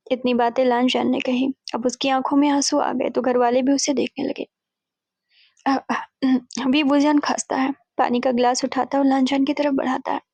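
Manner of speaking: ordinary delivery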